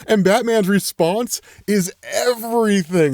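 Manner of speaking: laughing as you gush; laughing delight. Real, not acted. Reviewer gushing to viewers over a comic panel, laughing.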